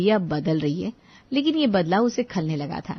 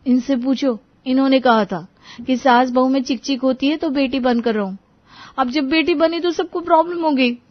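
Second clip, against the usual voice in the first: higher-pitched, aggrieved protest; accusing wife; 5 semitones higher, 4 dB louder than usual. A narrator's reading. Riya blaming husband for the daughter idea, aggrieved. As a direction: pin the blame on him, pitch climbing.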